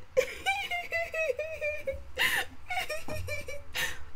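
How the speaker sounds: long, high-pitched laughter; real laughing fit. Real, not acted. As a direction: break into helpless high giggles. Laughs at own button accident.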